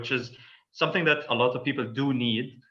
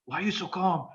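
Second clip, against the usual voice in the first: higher-pitched imitation of an annoyed person; mildly annoyed; 6 semitones higher than usual. Yelling person challenging the calm speaker, mildly annoyed. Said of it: "they get annoyed and like".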